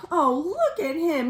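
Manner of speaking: plain reading voice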